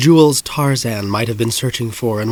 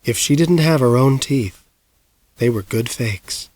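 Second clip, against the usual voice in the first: plain reading voice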